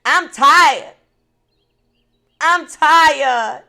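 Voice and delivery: loud, high, slow desperate plea; pleading prayer to God. Retold or imitated. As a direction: cry out to God that you are tired and used up. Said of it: "I told God, I said".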